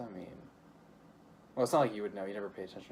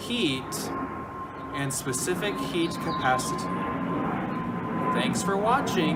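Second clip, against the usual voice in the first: sarcastic; 6 semitones higher, 5 dB louder than usual. Acted. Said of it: "You sound like you're having a great time".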